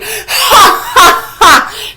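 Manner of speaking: airy high laughing fit; loud breathy cackle. Acted. Scolded library patron losing it in loud laughter. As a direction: burst into uncontrollable laughter.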